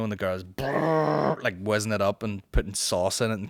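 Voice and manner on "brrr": drawn-out buzzing brrr; imitated blender whirr. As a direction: mimic a blender whizzing. Imitates coffee blender noise.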